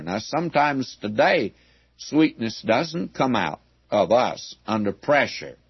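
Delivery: ordinary delivery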